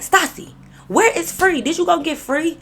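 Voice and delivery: high, urgent questions; urgent questioning. Retold or imitated. Confronting her friend about where Free went, high and urgent. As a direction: press her hard for an answer.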